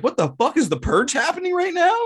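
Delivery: high, squeaky imitation of his startled self; mock panicked voice. Roused at two a.m., mocking his own panic. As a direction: squeal it like you just woke up scared. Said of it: "and you're like".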